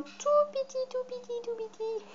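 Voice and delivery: very high, soft little squeak; tiny cutesy voice. Pretend. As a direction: shrink the voice to match something tiny. Playfully showing a tiny eraser.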